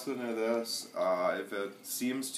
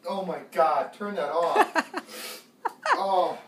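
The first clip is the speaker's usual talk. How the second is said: breathless, high laughing recovery; laughing disgust; 9 semitones higher, 10 dB louder than usual. Real, not acted. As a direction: still reeling and laughing. Recovering from vile taste.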